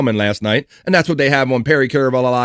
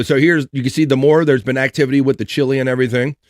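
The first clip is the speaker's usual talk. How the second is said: ordinary delivery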